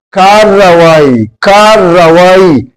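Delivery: کارروائی spelled out slowly, doubled r held; slow model pronunciation. Shown as a demonstration. Teacher to students, slowly modeling the correct word. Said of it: "حرف رے جو ہے یہ دو مرتبہ بولا جاتا ہے".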